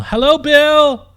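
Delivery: calling cheerfully into the woods. A narrator's reading. Princess calls to giant.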